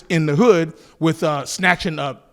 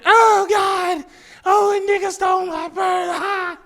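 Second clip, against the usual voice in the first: put-on shrieking old lady; 12 semitones higher, slower than usual. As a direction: play the robbed old lady crying out in a high voice. Old lady voiced in story.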